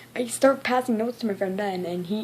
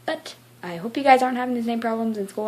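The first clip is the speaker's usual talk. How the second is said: ordinary talk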